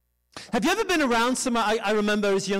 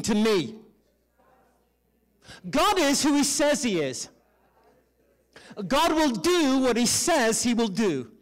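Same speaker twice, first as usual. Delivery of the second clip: leading a declaration. Shown as a demonstration. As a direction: proclaim it in measured phrases for a crowd to echo. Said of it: "say this out loud with me".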